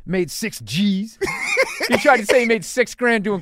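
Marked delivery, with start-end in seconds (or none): 0.1-1.2 slow, drawn-out brag, higher and louder; imitating a bragging host. Comic mimics the host bragging to the crowd, slow. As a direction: stretch out the boast. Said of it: "He goes".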